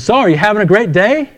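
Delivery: lightly voiced chirpy store clerk; cheery checker. Checker turns to the man in line, lightly voiced cheery greeting.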